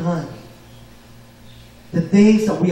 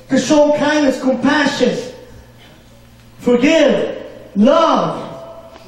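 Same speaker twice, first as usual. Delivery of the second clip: higher, fervent preacher's call to the congregation; preaching at full force; 6 semitones higher than usual. Acted. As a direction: lift the voice and rally the flock. Preacher rising to a peak.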